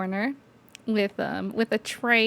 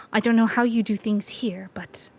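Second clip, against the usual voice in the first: whispering. Acted. Knight aside in whisper.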